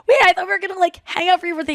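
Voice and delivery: high-pitched, puzzled protest; object, caught off guard; retold surprised objection.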